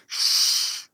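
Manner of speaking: long unvoiced shush; imitated audience shush. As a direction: hush someone in a cinema. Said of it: "everybody around me was like".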